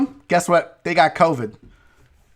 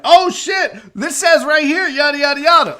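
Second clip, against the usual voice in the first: much higher, louder mock radio alarm; excited retold outburst; 7 semitones higher, 4 dB louder than usual. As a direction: act out your breathless on-air discovery. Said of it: "I've been on the radio and been like".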